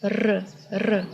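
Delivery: R trilled alone, two separate rolls; rolled R. Shown as a demonstration. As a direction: roll each "r" on the tongue tip. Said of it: "roll this letter".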